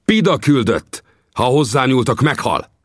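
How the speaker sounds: mild mock shout of a threat. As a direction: yell the warning over the crowd. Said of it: "a fülébe kiáltottam".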